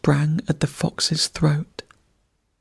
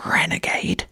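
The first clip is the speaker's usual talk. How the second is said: ferret snarl. A narrator's reading. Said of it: "snarled the ferret".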